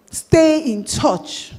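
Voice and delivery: "Stay in touch" said very slow with weight on "stay"; teacher hammering the point; slow emphasis. Shown as a demonstration. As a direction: drag out "Stay in touch" to drive it home. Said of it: "I'll keep emphasizing it".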